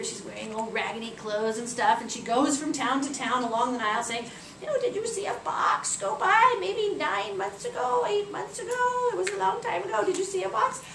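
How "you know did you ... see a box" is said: put-on old beggar woman. Disguised Isis asking along the Nile, old beggar woman. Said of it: "she disguises herself as an old beggar woman".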